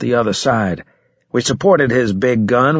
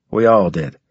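usual speaking voice

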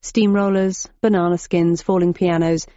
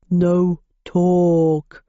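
basso profundo. A narrator's reading. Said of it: "said the Minotaur in a basso profundo".

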